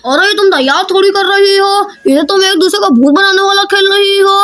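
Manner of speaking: usual speaking voice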